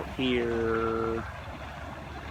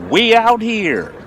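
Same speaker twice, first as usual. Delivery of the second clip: excited shout; 11 semitones higher, 7 dB louder than usual. Real, not acted. Fishing vlog catchphrase shout.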